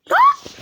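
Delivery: very high loud shriek; piercing scream. Real, not acted. Gamer frightened by a monster, shrieking. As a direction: scream in real terror. Jump scare in game.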